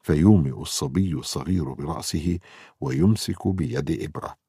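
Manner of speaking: ordinary delivery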